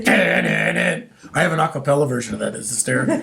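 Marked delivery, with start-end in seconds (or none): 0.0-1.1 very low sung dun dun dun; deep dun dun sting. Retold or imitated. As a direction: drop into a deep dramatic sting.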